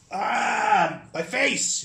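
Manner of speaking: high, loud anguished yell; yelling in pain. Acted. Cyprianus hit in the face, yelling in high pain. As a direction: wail over a ruined face. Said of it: "he yells".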